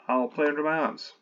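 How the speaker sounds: normal talking voice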